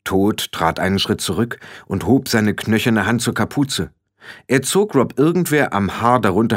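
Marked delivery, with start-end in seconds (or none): none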